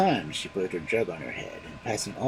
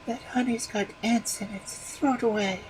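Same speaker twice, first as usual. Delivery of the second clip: high, picky wife voice; fussy wife; 12 semitones higher than usual. A narrator's reading. Wife sending the honey back, high and fussy. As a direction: wave the honey away, high and demanding.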